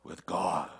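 low booming voice of God, lightly done; mock God voice. Shown as a demonstration. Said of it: "Let me get my God voice on".

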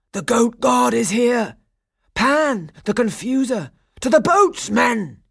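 high, loud shouted alarm; panicked cry. A narrator's reading. Pirate chief warning his men in panic, high loud shouting. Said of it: "he cried".

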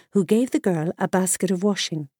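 ordinary delivery

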